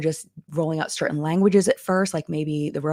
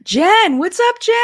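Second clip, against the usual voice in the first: much higher and louder, delighted; excited greeting; 9 dB louder, slower than usual. Real, not acted. Streamer calling out to a longtime member in chat. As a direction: call out to her like a friend.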